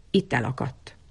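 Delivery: ordinary delivery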